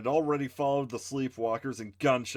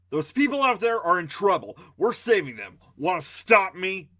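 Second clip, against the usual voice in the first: defiant challenge; 6 semitones higher, 7 dB louder than usual. Acted. Hero challenging Mikey.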